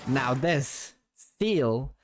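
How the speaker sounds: usual speaking voice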